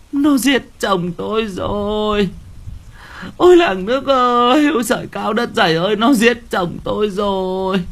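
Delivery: giọng cao, kể lể thảm thiết; gào khóc kêu làng nước. A narrator's reading. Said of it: "Bà cụ lắp bắp". (bà cụ kêu khóc với dân làng, giọng cao thảm thiết)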